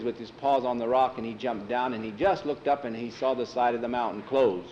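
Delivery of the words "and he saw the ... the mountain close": usual speaking voice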